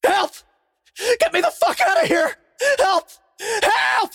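desperate screams for help; panicked shout. Acted. Banging on doors, screaming help.

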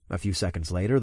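ordinary delivery, nothing marked

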